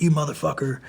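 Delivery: high-pitched mock taunting; retold trash talk. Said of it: "he's, like, talking shit to me".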